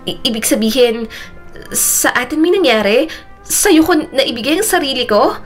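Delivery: high, halting disbelieving questions; shocked stammer. A narrator's reading. Mona realizing she slept with a stranger, shocked stammer.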